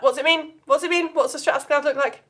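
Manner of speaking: ordinary talk, nothing marked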